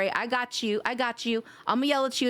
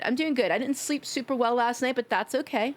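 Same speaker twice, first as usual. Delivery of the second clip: ordinary talk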